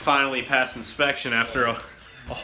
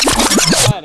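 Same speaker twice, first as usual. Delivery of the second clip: high booming all right; loud shout; 12 dB louder than usual. Real, not acted. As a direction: belt it out like an intro. Intro shout, +34 semitones.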